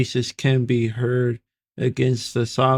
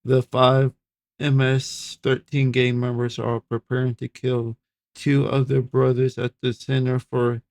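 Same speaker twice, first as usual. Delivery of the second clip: ordinary talk, nothing marked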